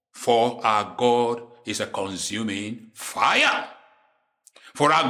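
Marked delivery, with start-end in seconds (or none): 0.1-3.7 slow, deep and weighty, drawn out to the end; slow, low, solemn. Shown as a demonstration. Preacher to his flock, a slow low solemn warning. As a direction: let the words fall slowly like a grave warning.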